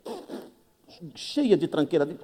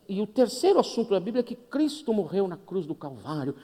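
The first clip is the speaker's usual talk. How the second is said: normal talking voice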